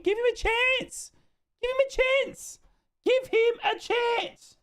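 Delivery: very high, straining squeaky plea; squealed plea. Real, not acted. Streamer begs fans to back Severa, squealed plea. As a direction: plead in a squeaky high pitch, stretching it out. Repeated plea for criticised player.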